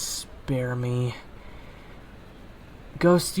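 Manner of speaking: ordinary talk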